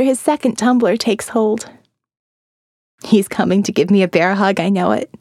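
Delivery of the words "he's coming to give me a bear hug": ordinary delivery, nothing marked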